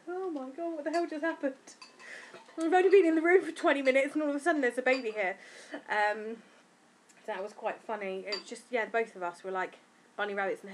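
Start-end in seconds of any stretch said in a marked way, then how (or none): 0.1-5.4 higher, stunned imitation; dazed disbelief; mock shock.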